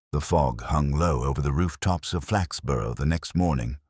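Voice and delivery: usual speaking voice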